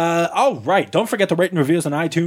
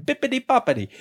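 fake Italian; 7 semitones higher than usual. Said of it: "that's not Italian, by the way".

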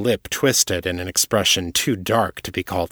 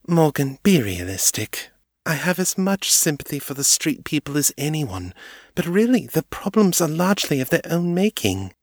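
higher, haughty noblewoman's voice; a lofty, pitying aristocrat; Amelie, condescending; 9 semitones higher than usual. A narrator's reading. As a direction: look down on them from a great height. Amelie dismisses street people.

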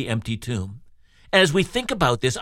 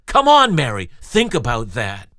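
exasperated plea; 3 semitones lower, 4 dB louder than usual. Acted. Preacher calling out to Mary, exasperated and loud. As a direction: burst out, urging Mary to see it.

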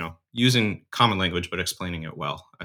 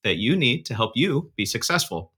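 upbeat host welcome, louder and higher at the start; podcast intro read; 4 semitones higher, 3 dB louder than usual. Acted. Host welcomes church communicators to the podcast, upbeat announcer read. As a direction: sell the show to the listeners.

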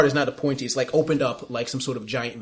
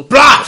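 sudden loud creature bite noise; explosive chomping bleh; loud monster chomp; 12 semitones higher, 16 dB louder than usual. Acted. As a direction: blurt a big beastly gulping sound.

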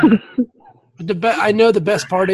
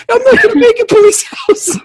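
melodramatic dying cop. Pretend. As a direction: overact a dying hero's last words.

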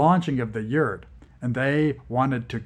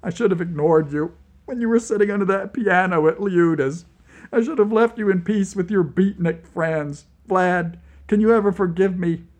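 sobbing; 7 semitones higher, 6 dB louder, faster than usual. Acted. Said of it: "I began sobbing".

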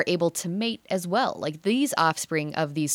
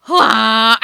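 loud strangled squawk; choked comic bird cry; strangled hybrid owl call; 4 semitones higher, 11 dB louder than usual. Retold or imitated. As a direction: squawk like two owls being strangled. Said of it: "it sounds like".

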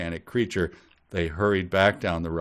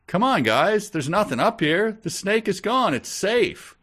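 high-pitched, raised-voice call; yelling down; 12 semitones higher, 5 dB louder than usual. A narrator's reading. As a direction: call down to the men below. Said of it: "He yelled down".

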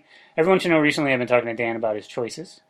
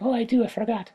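tiny creature voice; 8 semitones higher, 5 dB quieter than usual. Acted.

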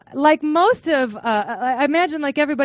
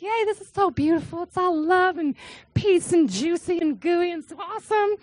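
higher, bubbly and starry-eyed; gushing excitement; 5 semitones higher than usual. Retold or imitated. Young grad student gushing about beautiful peace and love. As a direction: gush like a giddy new convert. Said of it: "I was all excited".

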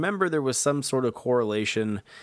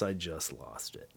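ordinary talk

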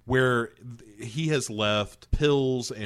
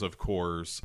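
normal talking voice

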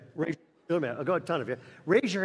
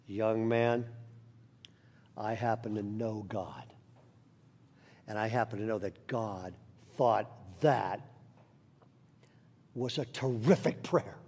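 put-on gentle old man; 9 semitones lower, 6 dB quieter than usual. Old man gently comforting the crying boy. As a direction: lean in kindly to a scared boy.

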